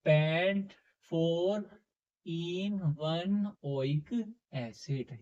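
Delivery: slow, stretched-out compound name, piece by piece; drawn-out recitation. Shown as a demonstration. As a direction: draw out each part of the chemical name. Reading out the assembled compound name. (नाम को धीरे-धीरे, खींचकर बोलते हुए)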